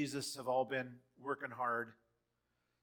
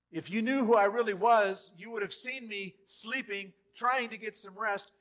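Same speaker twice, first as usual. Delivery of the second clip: louder, higher passionate plea; rising fervor; 7 semitones higher, 10 dB louder than usual. Acted. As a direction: preach it with heat. Jesus voiced, building.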